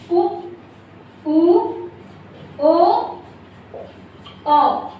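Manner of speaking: each vowel drawn out with rounded lips; demonstrating the vowels उ ऊ ओ औ one by one; vowel demo. Shown as a demonstration. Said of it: "इनको बोल के देखिए".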